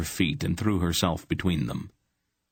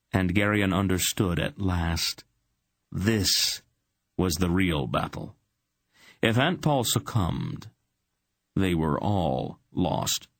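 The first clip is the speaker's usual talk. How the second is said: plain reading voice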